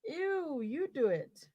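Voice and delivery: high, squeamish squeal; disgusted. Acted. Partner refuses the buzzing toy, squealing in disgust. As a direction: recoil in disgust and hand it over.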